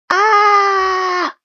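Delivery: piercing high shriek of disgust; shrill scream of horror. Acted. Mother Witch finds the nice book, horrified shriek. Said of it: "screamed Mother Witch".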